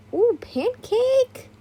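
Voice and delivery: high, slow squeal of delight; a sudden gleeful coo. Real, not acted. Reader breaks off, amused aside.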